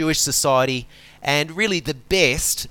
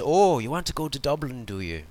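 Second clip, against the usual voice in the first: bad Irish accent. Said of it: "Sorry, I'm not very good at Irish accents".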